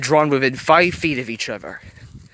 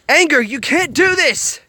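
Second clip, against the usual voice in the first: much higher, loud pleading shout; 6 dB louder than usual. A narrator's reading. Joy shouts at Anger to stop, in a high loud plea. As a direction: shout to stop her, hitting do. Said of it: "Joy shouted".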